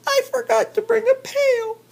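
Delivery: very high squeaky voice with a nervous laugh; tiny chipmunk voice, laughing. Acted. Chipmunk laughing off Bear's warning, high squeaky voice. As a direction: giggle it out high and small.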